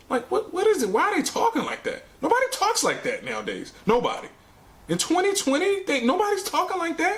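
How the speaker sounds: pitched well up in disbelief; baffled, can't believe it; incredulous. Real, not acted. Reviewer baffled by the characters' old-timey talk, incredulous and high.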